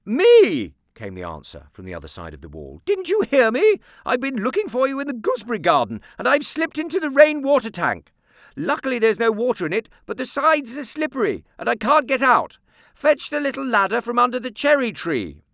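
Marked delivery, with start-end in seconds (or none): 0.0-0.7 high, loud cry from behind the wall; aunt calling. A narrator's reading. 2.9-15.4 high, loud urgent aunt voice; trapped aunt. A narrator's reading. Aunt stuck in tank.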